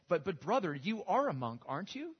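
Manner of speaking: puzzled surprise. Retold or imitated. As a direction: act baffled, pushing the question up. Puzzled monk quoted in story.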